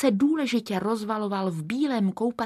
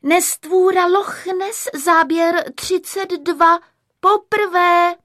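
high, loud film-slate call; clapper announcement; 8 semitones higher, 7 dB louder, slower than usual. A narrator's reading. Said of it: "slečna Klapka klapla klapkou a ohlásila". (hlasité vysoké ohlášení klapky)

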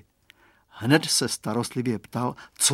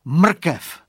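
sudden bright idea, higher and louder; exclaimed; 5 semitones higher, 7 dB louder than usual. A narrator's reading. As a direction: call it out with the idea.

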